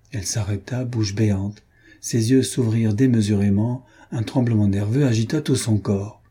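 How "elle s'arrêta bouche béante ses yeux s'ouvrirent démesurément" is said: usual speaking voice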